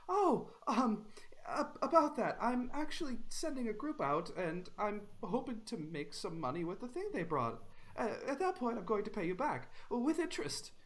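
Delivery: high, stammering, scared excuses; a nervous debtor squeaking out promises. Acted. Debtor pleading high and nervous with the bandit.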